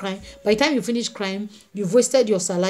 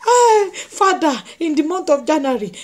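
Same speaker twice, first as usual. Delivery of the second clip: long, high wailed cry to Father; hammed-up tearful appeal; mock crying plea; 5 semitones higher than usual. Jobless believer crying to the Father, mock wailing. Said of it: "start crying and say".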